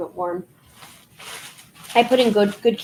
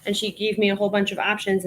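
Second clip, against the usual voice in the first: ordinary delivery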